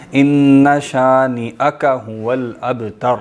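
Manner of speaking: ان شانئک هو الابتر with a clear glottal catch on the hamza, very slow; slow Quranic recitation. Shown as a demonstration. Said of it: "یہاں جھٹکا آئے گا". (حمزہ پر جھٹکے کے ساتھ آہستہ تلاوت)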